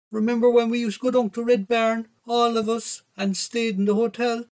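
high, steady motherly voice; high-pitched mother soothing her son; reminiscing mother. A narrator's reading. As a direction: pitch it up as a mam recalling good times.

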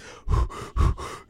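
voiceless imitated ape huffs; short puffs of mock animal breath; huffy gorilla panting. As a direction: huff like an annoyed gorilla. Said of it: "like, all huffy".

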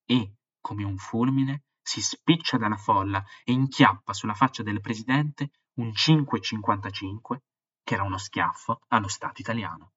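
a storyteller bringing a disaster to life; dramatic story reading. A narrator's reading. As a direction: read the excerpt with rising drama. Dramatic reading of book excerpt.

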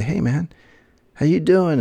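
high, bright mock call to a runner; cheery greeting. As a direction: call out friendly and loud to a stranger. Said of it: "and they say".

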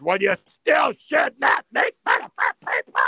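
high, loud shout on every word; screaming at him. Retold or imitated. Heavy woman at the bar screaming at the comedian, loud high shout. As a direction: yell it right in his face. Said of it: "started screaming".

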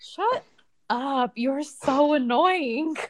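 high, slow, fed-up scolding; exasperated. Retold or imitated. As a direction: drag it out, annoyed at herself. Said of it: "I'm like".

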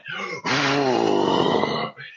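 a sudden, strained gasp with the whole body flexed; sharp gasp. Retold or imitated. Retelling the mirror moment to a friend, with a sharp gasp. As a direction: gasp hard, like pushing something out. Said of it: "and I went".

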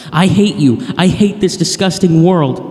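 loud, high cry of hatred. A narrator's reading. Zane cries out as he is thrown into his cell, crying out in hatred. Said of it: "he cried out".

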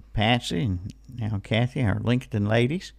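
normal talking voice

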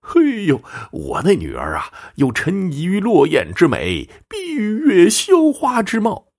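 put-on high, drawn-out old-woman gushing; proud boasting. Old mother praising her daughter to the fortune-teller, proudly gushing. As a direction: savour each praise, climbing very high and slow. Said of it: "自豪地说".

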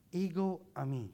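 "ego eimi" as EH-go ay-MEE, Greek vowels; "ego eimi" taught to repeat aloud. Shown as a demonstration. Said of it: "Say it with me".

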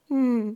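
mock Wookiee "hmm"; Wookiee noise. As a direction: imitate two Wookiees answering with a noise. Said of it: "they both just go".

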